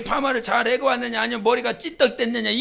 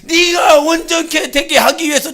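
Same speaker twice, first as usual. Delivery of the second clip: preacher shouting the point home; full-force preaching; 4 semitones higher, 9 dB louder than usual. Acted. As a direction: proclaim it to the whole church, voice raised high.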